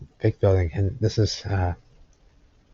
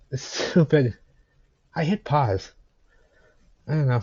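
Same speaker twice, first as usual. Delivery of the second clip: ordinary talk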